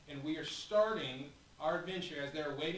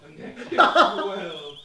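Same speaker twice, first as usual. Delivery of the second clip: laughing; 3 semitones higher, 17 dB louder, slower than usual. Real, not acted. GM laughing loudly.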